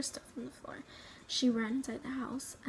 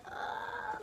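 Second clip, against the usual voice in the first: high sobbing gasp "ah"; child's high sob of shock; sobbing cry; 12 semitones higher, 4 dB quieter than usual. Retold or imitated. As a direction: let out a high, broken sob. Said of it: "And I was crying".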